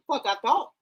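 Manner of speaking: ordinary delivery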